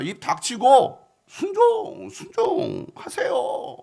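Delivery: high-pitched, insistent pleading; servants' exasperated plea. Acted. Servants begging the general to just wash, exasperated high plea. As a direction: urge him to give in, stretching the last words. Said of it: "부하들은 순종".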